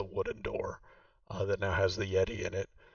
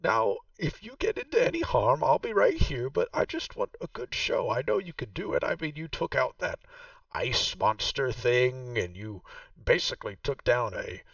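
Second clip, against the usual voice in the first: game master voicing Vaku, noticeably higher; Vaku's voice; 6 semitones higher, 4 dB louder than usual. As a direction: play the proud host showing her old home. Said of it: "She says".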